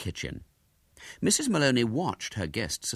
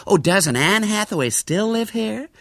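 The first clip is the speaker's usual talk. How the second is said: high, slow gush of excitement; excited American lady tourist; 13 semitones higher, 5 dB louder than usual. A narrator's reading. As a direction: ask in thrilled astonishment, louder and higher. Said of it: "said Miss Burford excitedly".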